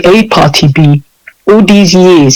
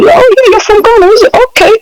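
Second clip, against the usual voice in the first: much higher, breezy mock voice; mock foreigner. Imitating a host accepting a Ghanaian, mock casual high voice. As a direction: play the easygoing local who shrugs it off.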